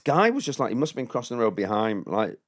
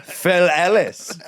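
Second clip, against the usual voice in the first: loud, high, hammed-up proclamation; mock grand announcement; 9 semitones higher, 5 dB louder, much slower than usual. Comedian turning round to a passer-by, mock grandiose. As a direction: proclaim it grandly, stretching the last words.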